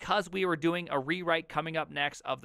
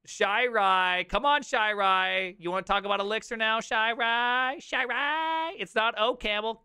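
high, loud sing-song heckle, climbing higher; mock taunting; 6 semitones higher, 4 dB louder, slower than usual. Streamer goads a functional programming fan, teasing. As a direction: needle them, stretching the name ever higher.

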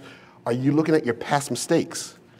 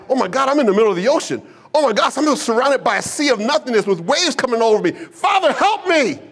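acted panic building to a high shout; panicked; 8 semitones higher than usual. Peter cries to Jesus amid the waves, mounting acted panic. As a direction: pitch climbs steadily into a desperate cry for help.

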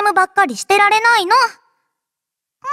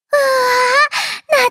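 delighted wow; 5 semitones higher, much slower than usual. Acted. Smells nostalgic sweets.

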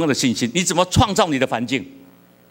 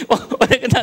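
laughing; 9 semitones higher than usual. Real, not acted. Laughs at his own joke.

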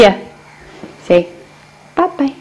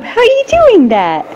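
much higher, sing-song cooing; 9 semitones higher, faster than usual. Real, not acted. Mother cooing to her wobbling toddler, high sing-song baby talk. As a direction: coo at the little one, pitched way up.

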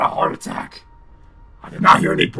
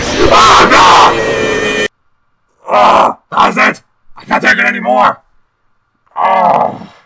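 loud exasperated yelling; furious panicked shout; 14 dB louder, much slower than usual. Real, not acted. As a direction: scream it, fed up and scared. Jump scared third time.

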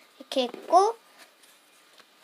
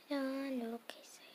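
whisper; 14 dB quieter, faster than usual. Real, not acted. Hushed sticker reveal.

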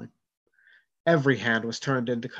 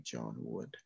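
much softer, sinking name; eerie hush; 9 semitones lower, 17 dB quieter than usual. Acted. Spirit asks John if he knows it, hushed. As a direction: let the name fade into the deep.

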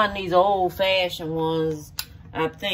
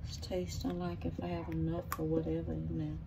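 whispered to herself; 2 semitones lower, 11 dB quieter than usual. Real, not acted. Sneaking a taste from the bowl.

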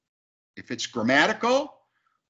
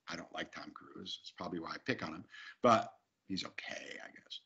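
hushed fast whisper; whispering a joke about Tom Cruise; whispered aside; 12 semitones lower, 14 dB quieter than usual. Real, not acted. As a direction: drop to a secret whisper.

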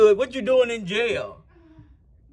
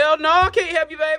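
indignant refusal; 9 semitones higher, 4 dB louder than usual. Acted. Refuses inmate's plea for help.

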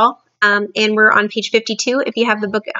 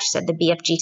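usual speaking voice

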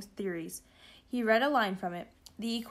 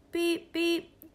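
high, level electronic beeps; computer beeping; 8 semitones higher, 3 dB louder than usual. A narrator's reading. Backpack computer sounds a warning, beeping. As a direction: imitate a computer's beep. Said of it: "came a noise from the backpack".

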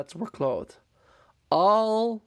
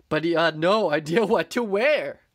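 ordinary delivery, nothing marked

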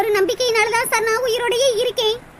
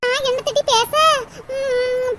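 ordinary delivery

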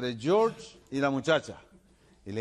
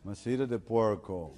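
put-on slow repeat in American-accented Spanish; slower than usual. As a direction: struggle through the Spanish like an American. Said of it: "[name] tiene que decir".

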